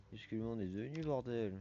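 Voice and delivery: ordinary delivery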